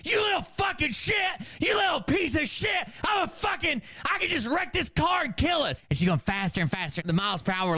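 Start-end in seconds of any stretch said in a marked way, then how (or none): 0.0-5.8 high-pitched hammed-up tirade; shrieking acted-out fury; screaming rage. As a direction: scream abuse like an enraged mom. Mom rages while driving.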